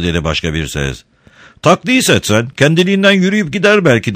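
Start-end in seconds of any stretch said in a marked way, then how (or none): 1.6-4.1 high-pitched shout from the crowd; calling out. A narrator's reading. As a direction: call out a heckle in a much higher voice. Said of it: "diye seslendi bir üçüncüsü". (tiz sesle seslenerek)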